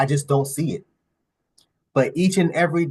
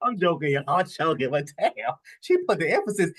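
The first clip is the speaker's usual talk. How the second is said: ordinary talk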